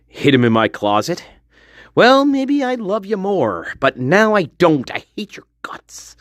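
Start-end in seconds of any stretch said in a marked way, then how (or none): 4.9-6.2 hushed mock whisper; whispered grudge to the husband. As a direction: hiss it under your breath.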